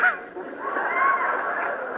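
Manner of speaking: a high, whooping burst of laughter; high whooping laugh at the joke; joyful whoop. Real, not acted. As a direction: let a shouting laugh fly up high. Preacher whoops at the bear story.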